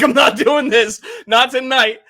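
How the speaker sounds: loud, high exasperated shout; fed-up outburst. Retold or imitated. Fan giving up on his team's game, loud high exasperation. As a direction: throw up your hands at the TV. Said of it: "I was like".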